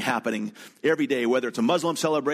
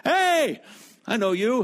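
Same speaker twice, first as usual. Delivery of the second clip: put-on high, loud happy greeting to an old friend; friendly hail; 10 semitones higher, 4 dB louder, slower than usual. Driver hailing a guard he knows, high loud greeting. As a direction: call out like you spot a buddy.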